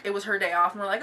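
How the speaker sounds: ordinary talk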